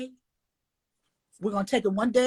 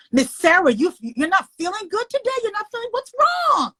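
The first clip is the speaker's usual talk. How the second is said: put-on higher, worried kid's voice; concerned child; 10 semitones higher than usual. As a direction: play a small student asking the teacher.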